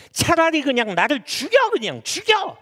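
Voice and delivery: high, loud acted demand; exasperated outburst. Jonah's prayer put bluntly to God, exasperated outburst. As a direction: throw it out in fed-up protest. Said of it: "그러니까 이 말은 뭐예요?".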